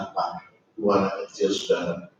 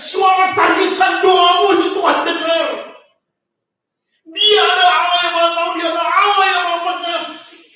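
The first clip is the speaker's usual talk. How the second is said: preaching at full force. Acted. Preacher shouting to the congregation that God hears, full force. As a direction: proclaim it to the whole congregation.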